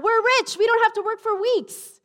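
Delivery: high, loud, gleeful shout of a jackpot; mock excited cry. Joking pastor voicing Peter over the catch, loud giddy cry. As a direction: cry out like a fisherman who struck it rich. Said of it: "No, it didn't say that".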